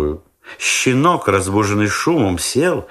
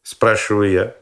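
plain reading voice

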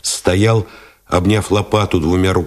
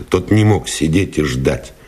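ordinary delivery, nothing marked

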